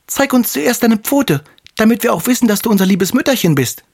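put-on high, piping kid-goat voice; wary little kids. A narrator's reading. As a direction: demand proof in a tiny high voice. (misstrauische Geißlein mit hoher Stimme)

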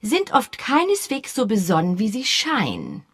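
lightly voiced prim spinster lecturing; lecturing old spinster. A narrator's reading. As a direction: lecture like a proper elderly spinster. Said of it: "dozierte sie im Ton einer alten Jungfer".

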